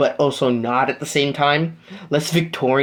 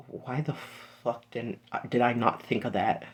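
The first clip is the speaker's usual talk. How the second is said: much softer, almost under the breath; muttered self-reproach; 4 semitones lower, 13 dB quieter than usual. Acted. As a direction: mutter it to yourself, annoyed. Izuku realizes his mistake.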